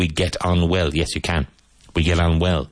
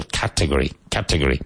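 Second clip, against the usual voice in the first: Category stressed on CAT, falling away after it; stress on first syllable; 3 semitones lower, faster than usual. Shown as a demonstration. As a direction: hit the first syllable of Category, lower on the rest. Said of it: "Emphasis on the first syllable".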